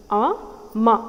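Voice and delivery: a, ma spoken as two separate beats; syllable by syllable. Shown as a demonstration. Teacher counting syllables for the class. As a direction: count out each syllable of amma. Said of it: "Amma has two syllable".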